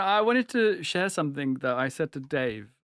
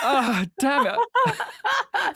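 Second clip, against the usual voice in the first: laughing; 13 semitones higher, much slower than usual. Real, not acted. Caught swearing, laughing.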